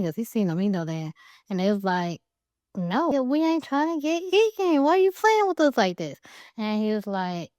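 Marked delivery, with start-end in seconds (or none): 2.8-6.2 pigs begging high and urgent, voice pitched up; high panicked pleading. Acted. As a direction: plead with the wolf in a high, rattled voice. Scared pigs pleading with wolf.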